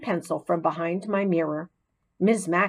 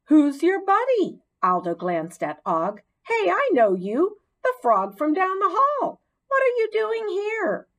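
1 surprised janitor; 11 semitones higher than usual. A narrator's reading.

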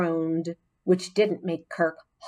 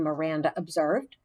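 usual speaking voice

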